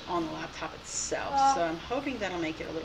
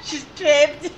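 loud, higher mock-Scottish echo; imitated Scottish accent; 6 semitones higher, 8 dB louder than usual. Player echoing the game character, mock Scottish accent. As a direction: parrot it loudly in a Scottish accent. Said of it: "it does talk like that too".

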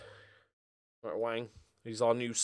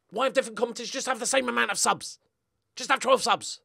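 higher, louder, fast complaint; exasperated rant; 10 semitones higher, 5 dB louder than usual. Real, not acted. Gamer rants about substitution rules, exasperated.

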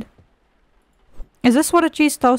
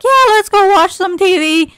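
high, loud mocking quote; sarcastic put-on cheer; mock nonchalance; 8 semitones higher, 5 dB louder than usual. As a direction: mimic a clueless person, exaggerated. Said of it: "you're like".

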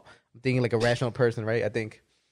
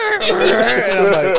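laughing; 6 semitones higher, 10 dB louder, much slower than usual. Real, not acted. Laughing retelling the burnt turkey.

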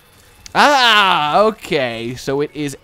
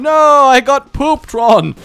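dismayed yell; 10 semitones higher, 10 dB louder, slower than usual. Real, not acted. Hit in game, cries out.

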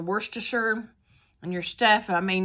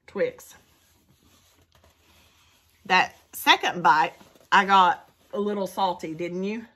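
muffled chewy speech; talking while chewing; 3 semitones lower than usual. Real, not acted. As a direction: mouth partly full of chocolate. Seen 0: Bites bar just before; chews and re-bites while speaking.

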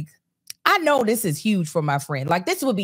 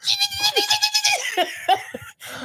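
high shouted laugh. Real, not acted. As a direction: howl with laughter. Laughing at Kevin's tears.

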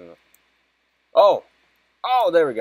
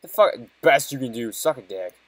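angry outburst; 3 semitones higher, 7 dB louder than usual. Real, not acted. Angry at the game.

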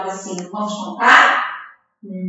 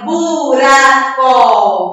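"buraco" stretched into bu, ra, co; drawn-out syllables; 5 dB louder, much slower than usual. Shown as a demonstration. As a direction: draw out each syllable of "buraco". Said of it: "pra separar".

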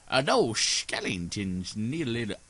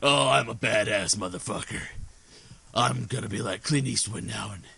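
mock Stallone; 6 semitones lower than usual. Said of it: "He's like".

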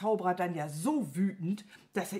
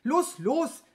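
louder, higher, impatient urging; 7 semitones higher, 6 dB louder than usual. A narrator's reading. Wizard in a hurry urging his servant on, impatient. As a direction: hurry the servant along sharply. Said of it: "wurde er ungeduldig".

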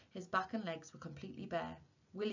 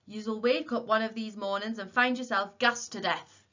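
loud, raised warning yell; 4 semitones higher, 10 dB louder than usual. A narrator's reading. Old warden calling after Tom and Willie, yelled. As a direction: shout a dire warning after them. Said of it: "he yelled".

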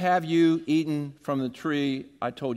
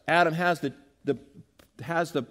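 ordinary delivery

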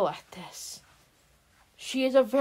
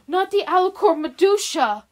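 shocked disbelief; 8 semitones higher, 7 dB louder than usual. Acted. Sister in shock at news.

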